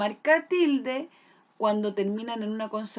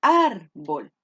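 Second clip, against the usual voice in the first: "Árbol" with a loud, long ÁR, then a short low bol; exaggerated stress; 5 semitones lower, 8 dB louder than usual. Shown as a demonstration. Teacher shows where the voice falls, exaggerated first-syllable stress. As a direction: punch and stretch the ÁR of "Árbol". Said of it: "¿Dónde se carga la voz?".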